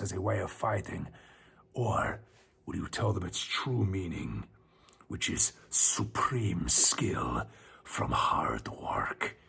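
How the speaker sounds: low, hushed sage with whispered stretches; slow wise master giving his speech softly; kung fu master. Retold or imitated. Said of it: "His Chinese master had a Spanish accent".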